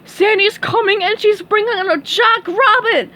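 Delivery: high, loud panicked starfish; alarmed cry. Acted. Patrick warns SpongeBob that Sandy is coming, loud alarmed cry. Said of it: "cried Patrick".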